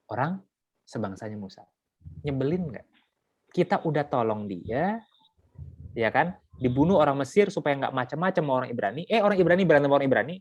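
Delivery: ordinary talk, nothing marked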